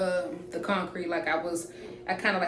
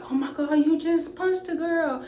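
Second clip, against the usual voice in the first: put-on high, shocked girlfriend voice; the girlfriend's high outraged cry at the man who punched a woman; 9 semitones higher than usual. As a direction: play her shocked and indignant. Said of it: "she's like".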